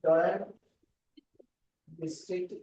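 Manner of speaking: word by word, long pauses between words; dictation. Shown as a demonstration. Teacher giving students the court's title to write, halting dictation.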